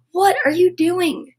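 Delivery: normal talking voice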